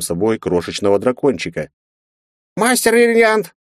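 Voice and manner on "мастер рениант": very high, tiny squeaking voice; squeaky baby dragon. A narrator's reading. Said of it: "пропищал Кроха".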